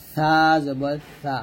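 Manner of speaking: সা long and thin, tongue tip on upper front teeth; thin articulation. Shown as a demonstration. Teacher showing students the letter, সা thin and slow. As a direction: lisp a slow সা lightly, like English "th" in thin. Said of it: "পাতলা করে লাগিয়ে".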